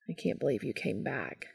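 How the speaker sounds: low, very soft and tender; quiet, tender near-murmur; gentle. A narrator's reading. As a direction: speak it gently, hushed and low. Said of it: "Cass said gently".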